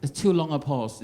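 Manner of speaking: ordinary delivery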